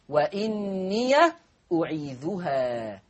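"وإني" mit geöffnetem Ya, langsam gedehnt; "وإني أعيذها" langsam, Ya mit Fatha; Warsh-Lesart vorgeführt. Shown as a demonstration. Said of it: "قرأها ورش بفتح ياء الإضافة".

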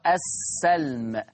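السلم with an open a on the sin; fatha on the sin. Shown as a demonstration. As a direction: say السلم as as-salm, with an a after the s. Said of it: "بفتح السين". (السلم بفتح السين: السَّلم)

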